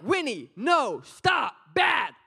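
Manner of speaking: loud, high scolding; mock scolding of a naughty puppy; scolding the dog. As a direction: snap at the dog for pooping inside. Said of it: "I can be like".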